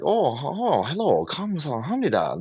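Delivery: fast, high mocking accent impression; mock an exaggerated Korean-accented greeting. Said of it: "he didn't speak with any, like".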